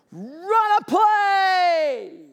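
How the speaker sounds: high, very slow stretched-out shout; fans screaming. Pretend. Preacher voicing angry fans at the team, stretched shout. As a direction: bellow it across the stadium. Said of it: "They're gonna be screaming".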